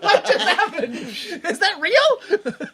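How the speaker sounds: high, loud, laughing disbelief; incredulous laughter. Retold or imitated. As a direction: squeal it through laughter, astonished. Retells own stunned reaction, laughing.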